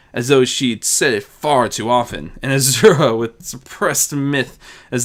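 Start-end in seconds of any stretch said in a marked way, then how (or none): none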